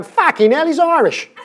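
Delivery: loud, high Cockney outburst; Cockney heckler. Retold or imitated. Cockney trader hearing the new economist, loud mock-Cockney disbelief. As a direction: shout it as an astonished Cockney trader. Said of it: "all I heard the coach going".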